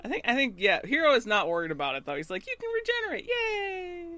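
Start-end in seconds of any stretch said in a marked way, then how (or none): 2.5-4.1 sing-song high-pitched mock encouragement; mock cheer. Acting out Hiro's carefree cheer.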